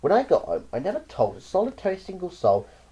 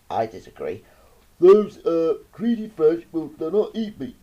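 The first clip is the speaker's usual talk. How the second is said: snail replies; 2 semitones higher, 5 dB louder than usual. A narrator's reading. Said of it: "The snail replied".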